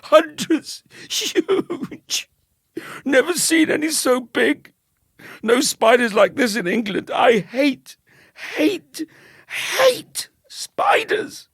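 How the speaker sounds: very high, loud wailing voice; frantic, tearful hatred of spiders; sobbing panic. Acted. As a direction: wail every word in hysterics. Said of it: "who was still sobbing".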